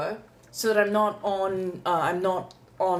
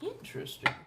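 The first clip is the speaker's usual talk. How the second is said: whispered; 6 dB quieter than usual. Real, not acted. Cards jumping from the deck.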